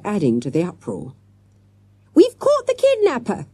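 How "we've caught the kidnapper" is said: put-on very high child's shout; excited shout. A narrator's reading. Jessica yanking the captive's beard, high-pitched shout. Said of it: "Jessica shouted".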